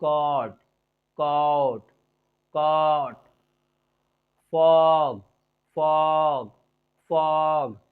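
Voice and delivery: "कॉट" and "फॉग" low, vowel drawn out; slow model "कॉट", "फॉग" for pupils to copy; accented open vowel. Shown as a demonstration. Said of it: "मेरे accent पर ध्यान दीजिए".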